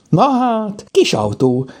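high exclamation of wonder; delighted cry. A narrator's reading. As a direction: cry out high over a surprise find. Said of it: "kiáltotta".

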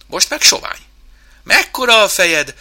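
high, loud gleeful exclamations; shouting. Acted. Chimp and mouse pass the mirrors, shouting merrily. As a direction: call out each funny discovery. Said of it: "kiabált".

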